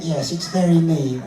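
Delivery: lightly voiced lady dummy; brief ladylike puppet answer; a hint of put-on femininity; feminine voice. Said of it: "let's find a nice feminine voice".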